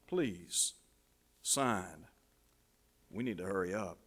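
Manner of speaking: low, soft, halting urgency; hushed pleading. Acted. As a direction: plead quietly, a pause after each phrase. Doctor pleads for the parent's signature.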